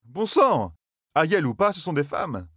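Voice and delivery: high voiced young man, exasperated; exasperated Matt. A narrator's reading. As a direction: protest that they're only women. Matt protesting. (Matt exaspéré, voix plus aiguë)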